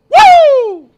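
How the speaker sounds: loud high whoop of glee. Real, not acted. Streamer cheering the interviewer, whooping. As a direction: let out a triumphant woo.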